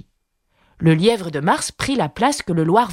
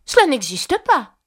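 colère; 8 semitones higher than usual. A narrator's reading. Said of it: "s'écria Alice avec colère".

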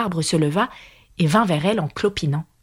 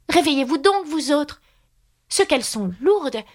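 impatient; 9 semitones higher than usual. A narrator's reading. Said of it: "continua-t-elle d'un ton impatienté".

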